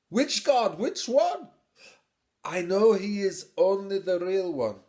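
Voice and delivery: a hint of a fussy old man; old father Terah. Old father Terah questions son.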